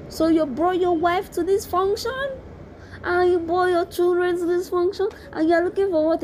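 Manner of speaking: high-pitched, incredulous questioning; scandalised rhetorical questions, high and insistent; indignant confrontation. Retold or imitated. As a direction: call him out in disbelief, voice pitched right up. Indignant retold confrontation of married man.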